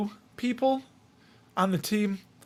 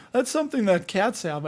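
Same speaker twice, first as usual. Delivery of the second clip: usual speaking voice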